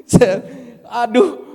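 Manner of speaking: slow laughing "aduh". Real, not acted. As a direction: laugh it out loud. Laughs at himself. (tertawa keras sambil berkata "aduh")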